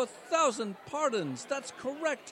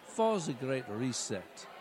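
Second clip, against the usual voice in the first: put-on much lower, German-accented villain voice; Klaus Schwab impression; 6 semitones lower than usual. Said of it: "Klaus Schwab".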